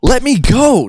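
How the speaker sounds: very high cry to be released; panicked protest. Acted. Damien's arm is pinned by Best, panicked.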